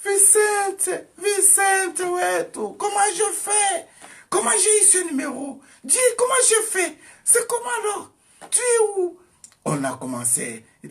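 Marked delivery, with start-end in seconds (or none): 0.0-9.2 much higher and louder, tearful pleading; crying out. Retold or imitated. Cousin on the phone begging her cousin, tearful high cry. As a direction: cry out his name as if sobbing. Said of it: "elle a commencé à pleurer".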